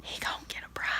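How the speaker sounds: very soft, breathy whisper; whispered aside. Real, not acted. Reader's conspiratorial aside to viewers, whispered. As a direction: lean in and whisper it.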